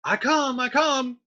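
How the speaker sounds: high, loud call toward the door. Acted. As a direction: shout it out to whoever is knocking. Friar calls to the knocker.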